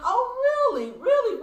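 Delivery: high, loud re-enacted surprise; retold amazement. Widow relives learning about the tennis player, amazed. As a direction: squeal it as you relive the surprise. Retelling her amazed reaction.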